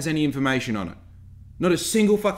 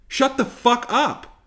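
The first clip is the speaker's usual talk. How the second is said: higher, louder, slow shut up; angry outburst; 8 semitones higher, 4 dB louder, slower than usual. Real, not acted. Vlogger telling online know-it-alls off, angry. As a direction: snap it out with disgust. Rant climax at commenters.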